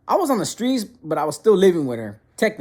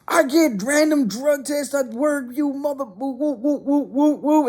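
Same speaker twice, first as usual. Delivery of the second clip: high-pitched, imitated ranting; mock angry mom; 9 semitones higher, slower than usual. Mother scolding son over work drug tests, high mock rant. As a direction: imitate a mother's tirade, trailing into nonsense syllables.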